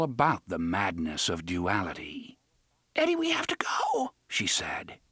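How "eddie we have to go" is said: high, breathless terrified plea; panic, words smeared together. A narrator's reading. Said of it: "Her terror turned the words into an unpunctuated auditory smear".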